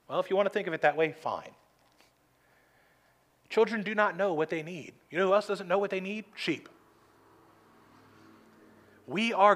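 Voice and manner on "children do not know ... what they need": usual speaking voice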